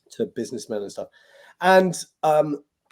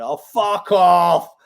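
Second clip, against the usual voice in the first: slow, louder mock heckle; hammed-up heckler shouting from the dark; imitated heckle shout; 5 semitones higher, 8 dB louder, much slower than usual. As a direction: drag out a rude heckle from the back. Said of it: "someone in the dark shouted".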